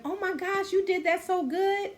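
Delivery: high, excited praise; a mock-delighted oh my gosh; gushing compliment. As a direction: gush over them like a proud friend. Acting out a compliment to someone.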